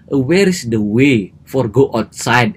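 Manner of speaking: slow, careful learner's English. Retold or imitated. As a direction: ask word by word, taking your time. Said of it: "Saya ngomongnya pelan". (ngomong pelan-pelan)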